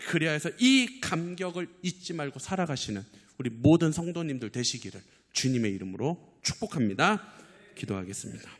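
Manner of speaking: ordinary talk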